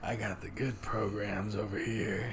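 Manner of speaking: low hushed dealer whisper; shady dealer. Pretend. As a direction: sell it like a back-alley dealer.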